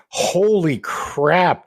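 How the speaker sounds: drawn-out high disbelief; stunned holy crap. Real, not acted. Cohost reacting to grim backstory, drawn out. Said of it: "That is like".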